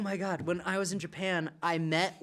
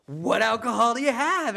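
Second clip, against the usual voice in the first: eager question; 6 semitones higher, 6 dB louder than usual. Retold or imitated. Retold eager question to bartender.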